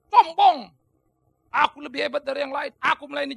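loud, proud snap of a word; arrogant. Acted. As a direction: spit it out with pride. Acting the arrogant voice. (sombong diucapkan lantang dan angkuh)

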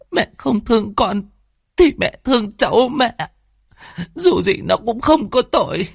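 high, plaintive pleading; weeping daughter imploring her mother; tearful begging. Acted. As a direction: beg through tears, stretching the last plea. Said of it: "khóc lóc van xin".

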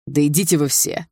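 angry, mildly forceful dismissal; hissing. A narrator's reading. Cornered brother tells everyone off, hissing. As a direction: spit it out as a restrained hiss. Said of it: "прошипел Руслан".

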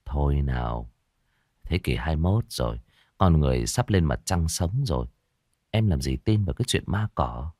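calm her down gently; soothing boyfriend. A narrator's reading. Boyfriend reassures his frightened girlfriend, softly. Boyfriend dismisses ghost talk.

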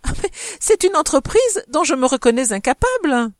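lively, high and emphatic protest. A narrator's reading. Madame de Morescan declines the marriage task, exclaiming gaily. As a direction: protest with playful good cheer. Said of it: "s'écria gaiement madame de Morescan".

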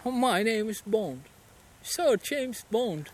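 slow posh British Bond intro; British accent. Retold or imitated. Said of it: "E agora o britânico". (sotaque britânico)

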